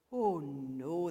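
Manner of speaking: low, loud, drawn-out refusal; old man. A narrator's reading. Old man refuses the kindly angel, low emphatic protest. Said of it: "the old man said".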